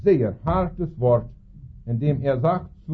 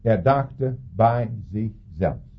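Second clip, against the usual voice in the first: "dachte" leaned on, whole line slow; slow, weighty repeat stressing "dachte"; slower than usual. Shown as a demonstration. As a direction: read it slowly, pressing "dachte". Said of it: "Das ist in Vers siebzehn".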